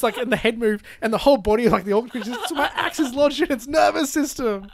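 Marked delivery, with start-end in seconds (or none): 2.5-4.7 higher and louder, laughing as you quote; giggling mock Gimli. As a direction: crack up while repeating the dwarf's line. Repeating Gimli's line, laughing.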